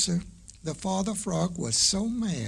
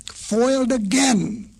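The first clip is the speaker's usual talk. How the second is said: higher, louder disappointed cry; angry birds; 6 semitones higher, 4 dB louder than usual. A narrator's reading. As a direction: grumble at the lost meal, loudly. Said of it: "cried the angry birds".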